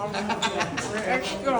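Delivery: "Yung ex ko" stretched very slowly, rising at the end; slow drawn-out. Shown as a demonstration. Repeating it slowly to the director for a laugh, drawn out. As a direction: drag out "Yung ex ko" slowly for a wistful effect.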